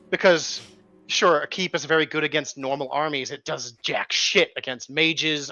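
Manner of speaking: ordinary talk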